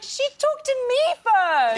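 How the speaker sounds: high, drawn-out imitated protest; mock protest. Mom defending chatting to a star, high mock protest. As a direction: stretch it out high like a defensive parent.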